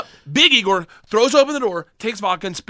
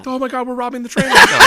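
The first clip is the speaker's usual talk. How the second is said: loud, higher mock alarm; alarmed realisation; 5 semitones higher, 7 dB louder than usual. Student realising it's a train robbery, alarmed. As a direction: exclaim it as it dawns on you.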